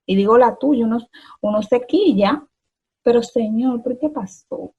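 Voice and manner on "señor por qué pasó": quiet plea to God. Retold or imitated. Retold prayer in hardship.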